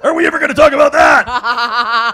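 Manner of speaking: high, loud, laughing mock taunt; teasing laugh. Teasing a fellow comic, loud and laughing. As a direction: tease her, cracking up.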